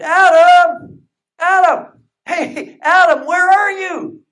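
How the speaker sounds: high, loud calling for Adam; God calling out loudly for Adam. Pretend. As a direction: call out across the garden, searching.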